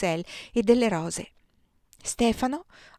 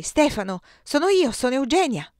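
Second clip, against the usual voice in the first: raised, higher call; 6 semitones higher, 4 dB louder than usual. A narrator's reading. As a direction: call up to your brother. Eugenia shouts up to her brother. (chiamata a gran voce)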